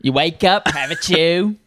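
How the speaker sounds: hammed-up British voice; mock British accent. Brit describing a morning chew, mock British accent. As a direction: play a bean-chewing Brit. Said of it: "I like the British act".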